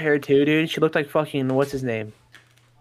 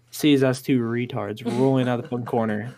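ordinary delivery

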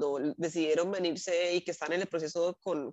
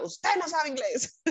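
mocking slap in the face; 6 semitones higher, slower than usual. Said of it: "Su cacheta".

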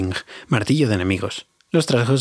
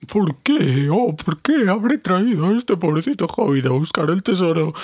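higher, put-on voice of the fat dwarf wailing; Bombur lamenting; 7 semitones higher, slower than usual. A narrator's reading. Fat dwarf moans while running, higher whining voice. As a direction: whine it out as the fat, sweating dwarf. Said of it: "decía el desdichado Bombur".

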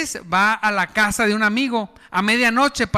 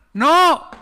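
sharp no; 6 semitones higher, 5 dB louder than usual. Acted. Neighbor refusing.

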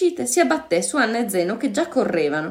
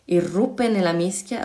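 ordinary delivery

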